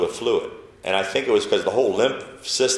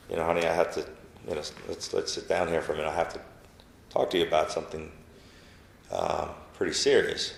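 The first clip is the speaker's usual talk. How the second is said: gentle serious news; 3 semitones lower, 7 dB quieter, faster than usual. Retold or imitated. Telling wife serious news, retold.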